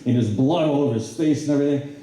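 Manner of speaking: ordinary talk, nothing marked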